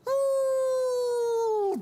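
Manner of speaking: very high, long "ooh" as if booted through the air; sustained falsetto whoop of a tiny creature sent flying; high cartoon wail held long; kicked Trid flying. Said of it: "He'd kick 'em and they'd go".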